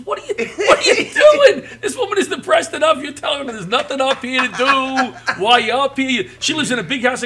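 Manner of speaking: high, loud incredulous scolding laughing through it; son scolding. Retold or imitated. Son scolding dad at a hotel, exasperated and laughing. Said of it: "my son looks at me and goes".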